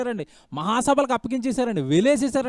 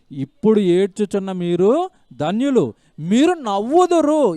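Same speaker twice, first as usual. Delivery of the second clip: weighty verse reading; 6 dB louder, slower than usual. Acted. Preacher reading the key verse slowly and loudly. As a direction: land the promise slowly.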